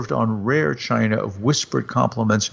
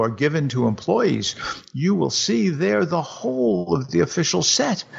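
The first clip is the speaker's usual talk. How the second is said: higher, eager husband pleading his case; a keen husband coaxing his wife; husband's voice; 7 semitones higher than usual. Acted. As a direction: raise the pitch for the hopeful husband.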